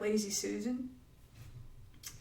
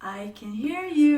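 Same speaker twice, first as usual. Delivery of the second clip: put-on calling out slowly to a hiding child; loud, slow call; yelling across the room; 5 semitones higher, 7 dB louder, slower than usual.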